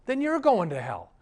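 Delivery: loud, high-pitched retort; retold stern warning. Pastor retorts to defiant girl.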